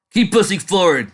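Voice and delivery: loud, high battle command; commanding shout. Acted. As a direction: bark the order over the battle. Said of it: "commanded the dragon".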